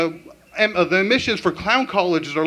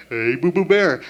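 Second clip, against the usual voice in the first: cartoon voice; 2 semitones lower than usual. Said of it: "talk to each other in cartoon voices".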